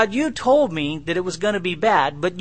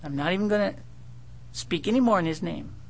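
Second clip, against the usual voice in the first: lightly voiced sulky young man, softer; a hint of a pouting young prophet; young guy sulking; 5 dB quieter, faster than usual. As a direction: mutter it like a stubborn youth. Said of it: "tonal inflection, kind of a young guy".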